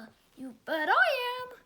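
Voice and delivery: plain reading voice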